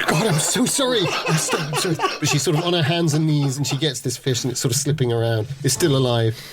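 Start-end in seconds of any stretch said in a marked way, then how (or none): 0.0-1.9 apologising while laughing, pitched up; giggling apology. Acted. Woman on her knees giggles an apology to him. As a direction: laugh through the sorry.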